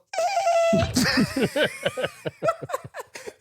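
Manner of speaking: long high helpless laughter. Real, not acted. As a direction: crack up high. Laughing while retelling.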